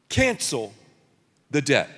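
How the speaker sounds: cancel, the debt, said slowly with a pause; deliberate pulpit phrasing; slow emphatic phrase. Shown as a demonstration. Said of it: "Say it with me".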